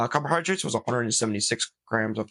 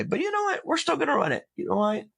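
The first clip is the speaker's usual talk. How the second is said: excited; 13 semitones higher than usual. Real, not acted. Psyching himself up for race.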